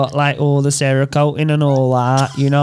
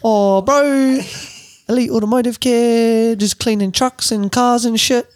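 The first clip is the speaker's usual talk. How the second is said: put-on higher-pitched, laid-back New Zealand accent; Kiwi accent; 7 semitones higher than usual. Host plugging car detailer on request, mock Kiwi. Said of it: "in New Zealand. Kiwi".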